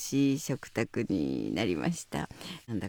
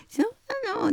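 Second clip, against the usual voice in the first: much higher cooing voice, imitating; a comedian cooing at a baby, very high; baby talk. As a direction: coo it like to a baby. Sanma coos at the baby.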